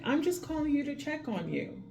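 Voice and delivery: a hint of sweet concern, pitched high; caring check-in. Nurse checks on the grumpy patient, light high caring tone. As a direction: gently ask after his heart, chest and head. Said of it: "I'm like".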